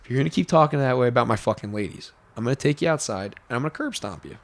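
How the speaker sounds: low, quiet, fast and cold threat; calm menace. Retold or imitated. Pimp threatening the drunk narrator, calm and quiet. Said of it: "just that calm".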